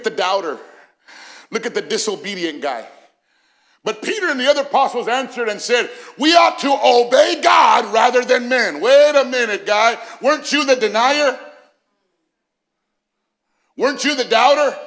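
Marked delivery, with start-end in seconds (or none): 3.8-14.7 loud, high preacher acting out the scene; raised, ringing sermon voice; impassioned preaching. Real, not acted. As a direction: declaim it like a challenge from the pulpit. Preaching at full force.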